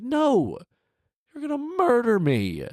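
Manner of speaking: high squeaky begging genie; pleading genie. Pretend. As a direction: beg to be let out in a high pitched voice. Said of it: "It's like".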